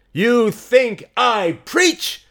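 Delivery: high, loud, slow retort; shouted challenge. Acted. Mary answering a heckler, slow shouted challenge. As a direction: throw the question back at the crowd, drawn out. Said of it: "shouted Mary".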